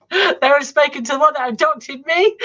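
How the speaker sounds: laughing. Real, not acted. Guest jokes about aliens who adopted them, laughing. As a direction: crack up at your own joke. Joking question about own embryos.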